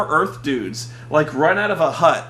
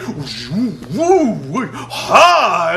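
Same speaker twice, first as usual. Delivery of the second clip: mock kung fu cries; 5 dB louder, much slower than usual. Earth dudes striking their pose, mock kung fu shouts. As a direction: yell like a posing martial artist. Imitating fighters' battle cries.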